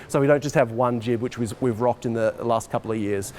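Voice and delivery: normal talking voice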